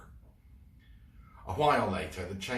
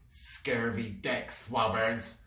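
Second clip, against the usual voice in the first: spat; 4 semitones higher, slower than usual. Acted. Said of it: "spat Lizzie Blood".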